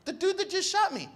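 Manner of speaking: high-pitched retold insistence; insistent explanation. Patient explains whom he forgives, high insistence. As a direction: spell out the obvious, pitched high.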